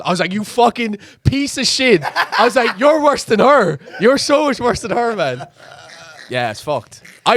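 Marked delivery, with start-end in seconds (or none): 0.3-5.5 raised, slower angry rant at a friend, half-laughing; retold anger. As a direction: tell off a friend who betrayed you, partly in jest. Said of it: "I was angry with him".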